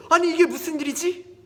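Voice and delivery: high, loud, panicked dismay; startled. Acted. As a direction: act out a shocked villain who doesn't know what to do. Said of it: "하만은 깜짝 놀래요".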